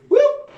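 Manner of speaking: high, loud slide-whistle "whoop"; quick high whoop as the undertow grabs; sudden whoop. Retold or imitated. Undertow suddenly pulls Mama down, high comic whoop. Sound of being pulled under.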